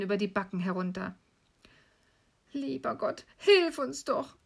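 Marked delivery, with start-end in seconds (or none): 2.5-4.4 pleading cry. Acted. Gretel praying in despair, high pleading cry. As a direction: plead for help in a high, tearful voice. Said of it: "rief sie aus".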